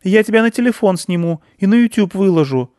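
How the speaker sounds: put-on high, loud childish threat; a small girl bravely bossing a monster; piping, cheeky warning; little girl threat.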